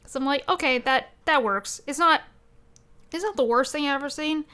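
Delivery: normal talking voice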